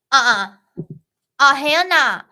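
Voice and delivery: loud, emphatic mock refusal; sassy refusal. Girl refuses attacker's order, loud sassy refusal.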